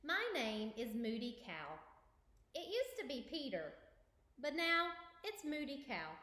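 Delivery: lively storybook narration; reading to children. Acted. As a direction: read it aloud for kids. Reads picture book aloud.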